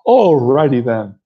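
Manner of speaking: loud, drawn-out comic catchphrase; Ace Ventura. Acted. Movie fan doing the catchphrase, exaggerated comic impression. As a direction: stretch it out big and zany. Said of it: "a good modern day Ace Ventura".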